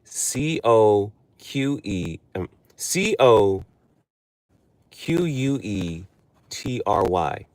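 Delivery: letter by letter, slow and halting; halting, slow spelling. Shown as a demonstration. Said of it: "the word coquetry".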